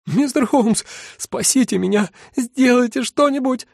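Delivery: shrill high panicked plea; terrified squeal. A narrator's reading. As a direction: squeal for help in terror. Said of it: "взвизгнул хозяин ресторана".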